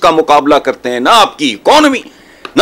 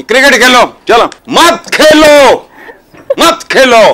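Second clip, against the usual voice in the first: louder, higher, scornful and fed up; angry outburst; 6 semitones higher, 3 dB louder than usual. Real, not acted. Pundit scolding the nation over cricket, angry and louder. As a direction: slam the words down in disgust. Heated rant against playing cricket.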